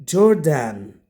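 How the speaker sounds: "Jordan" with a full second vowel, jor-DAN; wrong pronunciation. Shown as a demonstration. Teacher copies what some students say, "Jordan" ending in full "dan". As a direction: give "Jordan" a clear "dan" like the name Dan. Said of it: "That's wrong".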